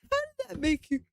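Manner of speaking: high, yawn-stretched therapist question. Pretend. Streamer mocking a yawning therapist with a patient. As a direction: play a therapist yawning mid-session. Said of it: "listening to your patients".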